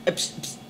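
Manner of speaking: whispered mock humping noise; breathy playful sound effect; low unvoiced sound effect. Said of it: "I have to make the sound effects".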